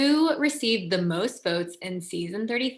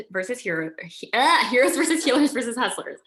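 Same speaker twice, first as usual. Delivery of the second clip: flustered stumble, then a louder, higher rush; tripping over words; 4 semitones higher, 4 dB louder than usual. Real, not acted. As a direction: trip on the title, then push through it fast. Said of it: "I butchered it".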